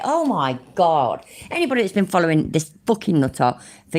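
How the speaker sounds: usual speaking voice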